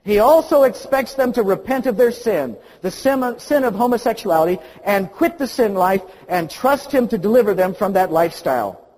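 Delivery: all caps. Retold or imitated. Reading the pastor's all-caps line aloud, loud and stern. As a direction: read it as if shouting in capitals. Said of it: "in all caps".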